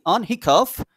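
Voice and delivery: hiccup as "hi-khaf", pitched high; pronunciation model. Shown as a demonstration. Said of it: "এগুলোর উচ্চারণ কি?".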